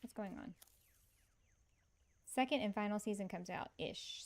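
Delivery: ordinary talk, nothing marked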